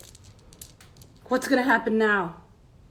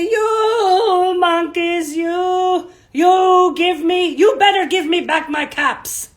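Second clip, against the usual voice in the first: sing-song shout, higher and louder; angry peddler; 7 semitones higher, 9 dB louder, slower than usual. Shown as a demonstration. Teacher leading children to shout along as the angry peddler. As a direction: lead the kids in the angry chant. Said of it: "Stamp your foot ... and say".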